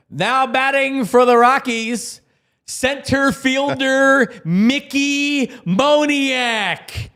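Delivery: high, drawn-out ballpark announcer call; spiker stadionowy. Acted. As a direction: announce the batter, stretching each word long. Imitates the stadium at-bat announcement.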